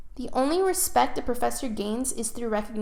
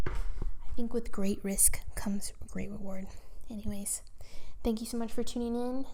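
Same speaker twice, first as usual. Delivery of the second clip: whispered. Real, not acted. Whispering a closing thought and thanks to listeners. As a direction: lean in and whisper the moral.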